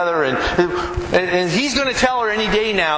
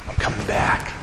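whispered promise; 5 dB quieter than usual. Acted. Jesus promising to return.

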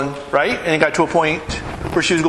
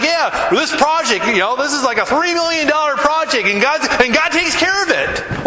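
a hint of a big dreamer shrugging; confident dreamer; 9 semitones higher than usual. Tristan describing his big camp project, lightly mimicked, higher. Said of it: "he's like".

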